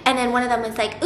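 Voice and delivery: usual speaking voice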